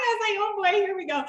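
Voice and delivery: laughing along, amused; giggly and delighted; still laughing. Real, not acted. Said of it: "I started laughing".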